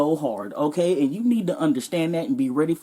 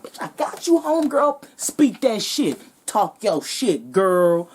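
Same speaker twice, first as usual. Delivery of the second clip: much higher, cheering homegirl; hype girl; 4 semitones higher, slower than usual. Retold or imitated. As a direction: cheer your friend on, high and drawn-out. Friend hyping Snow Bunny.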